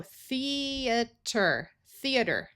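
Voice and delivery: theater said slowly, E and A apart; theater in syllables. Shown as a demonstration. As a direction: split theater into thee-uh-ter. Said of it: "This A will schwa".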